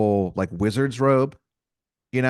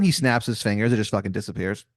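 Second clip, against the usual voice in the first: ordinary talk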